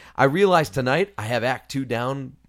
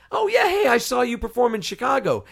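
much higher, eager fan call across the room; excited holler; 9 semitones higher than usual. Retold or imitated. Fan calling out to the Broadway star, excited and high. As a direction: blurt it out like a starstruck fan. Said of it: "so I holler out".

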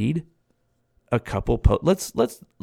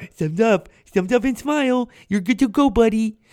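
higher, cheerful service voice; chirpy attendant voice; 7 semitones higher than usual. Retold or imitated. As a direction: sing it like a chipper attendant. Car wash attendant voiced cheerfully.

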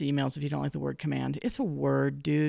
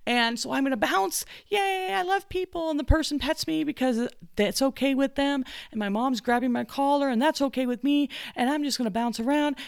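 high bouncy excited dog; 7 semitones higher than usual. Pretend. Happy dog explaining why it bounces, high excited voice. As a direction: play the goofy dog who loves everyone.

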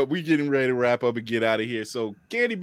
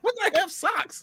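high, slow and laughing through the words; laughing disbelief; slower than usual. Real, not acted. Laughing at a shocking detail.

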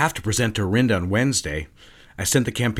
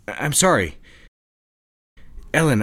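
usual speaking voice

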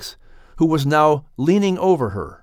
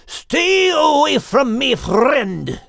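growling; 12 semitones higher, much slower than usual. A narrator's reading. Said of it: "already growling at Charlatan".

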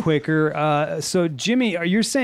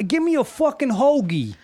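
higher, pushy Italian New York demand; a Philly-Italian guy barking for his sandwich, Italian New York accent; 7 semitones higher than usual. Retold or imitated. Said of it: "I do the Italian thing".

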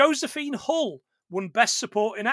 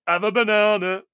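rowdy drawn-out Cockney shout; Cockney music-hall call; 8 dB louder than usual. Acted. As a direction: bellow it like a Cockney entertainer. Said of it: "you know the Cockney version of".

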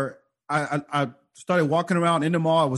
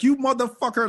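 higher-pitched mock outburst; raised, indignant retold yell; retold angry yell; 7 semitones higher than usual. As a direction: imitate an irate stranger cursing. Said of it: "he really yelled at me".

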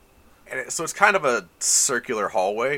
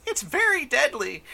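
much higher, squeaky fairy voice. Acted. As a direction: chirp it like a tiny fairy. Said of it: "As Fairy says".

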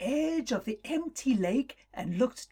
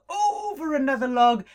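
plain reading voice

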